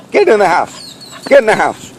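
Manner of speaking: high, loud firm command; ordering the dog. Real, not acted. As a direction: send the dog indoors now.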